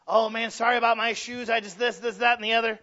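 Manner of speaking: hammed-up anxious apologizer; overly apologetic. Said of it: "they're always overly apologetic".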